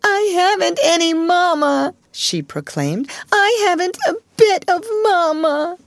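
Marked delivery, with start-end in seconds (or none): 0.0-1.9 put-on loud, high child's wail; bawling little girl at high pitch; wailing proclamation. A narrator's reading. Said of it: "preferred to lift up her voice". 3.3-5.8 put-on loud, high child's wail; bawling little girl at high pitch; wailing proclamation. A narrator's reading. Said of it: "preferred to lift up her voice".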